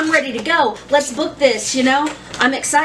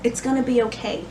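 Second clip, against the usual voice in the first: soothing husband; 8 dB quieter, faster than usual. Retold or imitated. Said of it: "And Kevin's just like".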